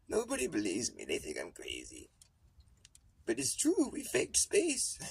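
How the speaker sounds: high, loud indignant grandpa; ranting old astronaut. Pretend. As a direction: insist like a crazy old man nobody believes. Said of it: "It's gonna be all like".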